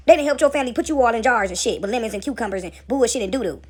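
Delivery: ordinary talk, nothing marked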